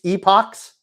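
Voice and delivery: "Epochs" said as EE-pocks, high and rising; alternative pronunciation. Shown as a demonstration. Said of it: "that word is pronounced differently".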